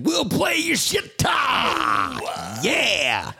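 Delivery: slow, high hype shout; announcer shout. Acted. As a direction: yell it like a jingle. Announcing the jingle segment.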